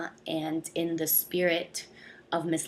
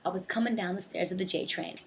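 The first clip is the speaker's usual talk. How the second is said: ordinary delivery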